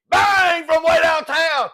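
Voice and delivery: loud, high, drawn-out sports call; excited American commentator. Acted. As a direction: shout it like a big basketball call. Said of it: "John Madden guys out there".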